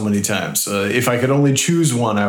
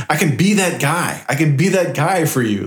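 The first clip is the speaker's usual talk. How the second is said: mock eager hero line; 5 semitones higher than usual. Answering Anna's request, mock eager hero voice. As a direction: puff up and promise like a hero. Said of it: "I'm like".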